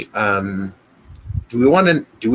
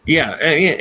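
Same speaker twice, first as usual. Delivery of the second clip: ordinary delivery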